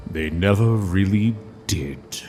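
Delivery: plain reading voice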